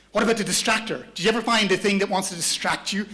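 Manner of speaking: normal talking voice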